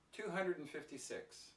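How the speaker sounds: "and" shrunk to a quick, weak "n"; natural reduced pronunciation. Shown as a demonstration. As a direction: run "hundred and fifty" together, "and" unstressed. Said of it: "It's very fast and it's very weak".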